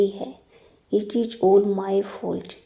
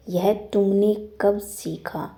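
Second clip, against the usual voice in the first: normal talking voice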